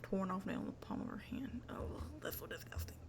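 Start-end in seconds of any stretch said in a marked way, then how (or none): 1.7-2.9 breathy whisper of disgust; a whispered "ew, gross". Real, not acted. Grossed out by story detail.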